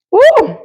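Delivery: high pitched woo, loud; high woo from the heat. Real, not acted. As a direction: yelp at the spice. Reacting to very spicy food.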